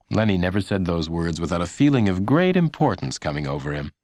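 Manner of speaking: ordinary delivery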